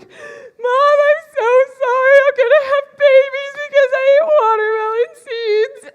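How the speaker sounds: put-on high, loud, crying child's apology; mock bawling. Little [name] confessing to her mom, mock sobbing. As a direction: wail it out like a sobbing six-year-old. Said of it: "went up to my mom bawling".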